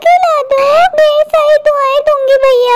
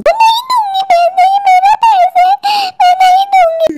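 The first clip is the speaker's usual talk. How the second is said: distressed refusal; 4 semitones higher than usual. Acted. Woman clinging to her purse from a robber, high and distressed. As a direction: cry out in panicky refusal.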